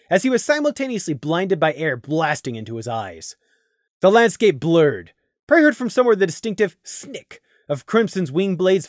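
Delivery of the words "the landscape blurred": ordinary delivery, nothing marked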